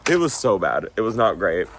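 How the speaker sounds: ordinary talk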